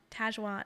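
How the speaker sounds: Tajwan with a short A, TAZH-wahn; mispronounced name. Shown as a demonstration. Quoting another mistake people make, Tajwan mispronounced with a short A. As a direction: say "Tajwan" with the A of "cat". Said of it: "everyone is like".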